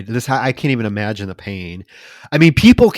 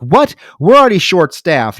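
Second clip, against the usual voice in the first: higher, louder indignant protest; mock mad; 6 semitones higher, 5 dB louder than usual. Karen to her boss about staffing, mock indignation. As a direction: act out an angry employee's disbelief. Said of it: "but she gets mad".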